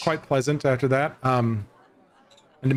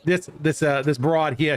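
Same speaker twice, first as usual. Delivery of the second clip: hammed-up New Yorker accent, pitched higher; lightly done New York tough guy; playful fake New Yorker; mock New Yorker; 4 semitones higher than usual. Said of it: "trying to do a New Yorker accent".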